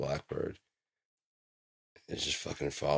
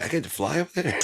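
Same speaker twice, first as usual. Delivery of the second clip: much higher, louder eager bird; excited bird; 11 semitones higher, 8 dB louder, faster than usual. Pretend. A bird thrilled to fly somewhere, much higher and louder. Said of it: "they always are like".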